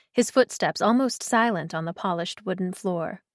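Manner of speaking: ordinary delivery, nothing marked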